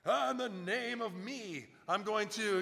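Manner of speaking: loud, high, drawn-out proclamation; hammed-up grand miracle worker; mock big showman. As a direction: boom it out theatrically, parodying. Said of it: "didn't do these big".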